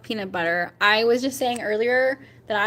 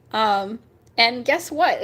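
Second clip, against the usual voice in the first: ordinary talk, nothing marked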